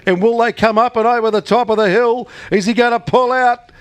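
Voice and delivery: rising, building excited race call; race caller. Real, not acted. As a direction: call the photo finish, climbing to a shout. Live race finish call.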